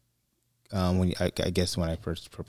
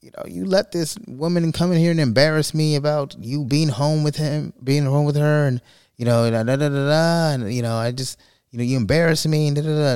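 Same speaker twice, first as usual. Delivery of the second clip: high, louder mock scolding of a husband; retold yelling; 8 semitones higher, 4 dB louder than usual. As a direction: rant at him in a raised, high voice. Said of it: "yelling at my grandfather".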